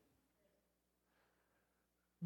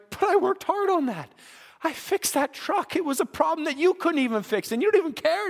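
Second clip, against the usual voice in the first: higher, plaintive protest from a worker let down by his boss; hurt complaint; 6 semitones higher than usual. Retold or imitated. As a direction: whine that the hard work went unnoticed. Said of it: "You're like".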